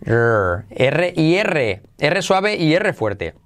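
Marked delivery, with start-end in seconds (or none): none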